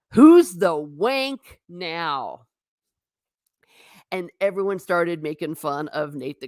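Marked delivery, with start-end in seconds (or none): 0.1-2.5 slow, louder gloating jeer; mocking taunt. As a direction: drag it out to rub it in. Mocking taunt quoted from Twitter.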